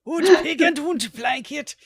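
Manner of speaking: high, slow mock German accent. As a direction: ham up a German accent, drawn out. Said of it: "it's fun to do the German accent".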